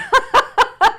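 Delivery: a burst of high, loud laughter; delighted high laugh. Real, not acted. Host laughs in amazement at the student's long words, high delighted laugh.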